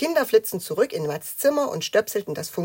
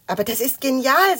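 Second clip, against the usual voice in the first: high, loud burst of enthusiasm; thrilled; 7 semitones higher, 8 dB louder than usual. A narrator's reading. Matz has a sudden plan, thrilled. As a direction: seize on a brilliant idea.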